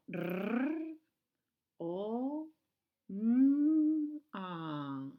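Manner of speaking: letras sonadas una a una, al revés; sounding backwards. Shown as a demonstration. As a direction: sonar R, o, m, a por separado. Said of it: "hacia el otro lado". (la mestra llig les lletres a l'inrevés)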